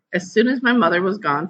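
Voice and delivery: ordinary delivery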